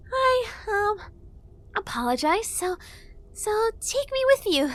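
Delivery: high, halting fairy apology; hesitant fairy, pleading; stammering fairy. A narrator's reading. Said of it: "she stammered".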